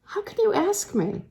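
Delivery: astonished, pitched high. A narrator's reading. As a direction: protest in disbelief. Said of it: "in her astonishment".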